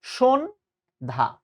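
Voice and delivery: সন্ধ্যা slowly as "shon ... dha"; syllable-by-syllable pronunciation. Shown as a demonstration. Teacher showing the easy answer, slow correct সন্ধ্যা as "shon-dha". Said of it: "সন্ধ্যা শব্দের প্রমিত উচ্চারণ হচ্ছে".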